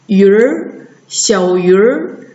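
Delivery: 鱼儿 blended, ü gliding into curled r; erhua demo. Shown as a demonstration. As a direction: model 鱼儿 and slow 小鱼儿 for learners. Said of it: "直接加儿一起读".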